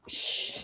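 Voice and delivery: soft, voiceless shush; quiet secretive shushing; hushing shh. Acted. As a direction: hush them with a long shh. Hushing the family in joke.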